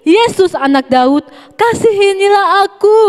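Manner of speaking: blind beggar crying out, pitch climbing high; calling out. A narrator's reading. Blind Bartimaeus cries out to Jesus from the roadside, high pleading call. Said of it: "mulailah ia berseru".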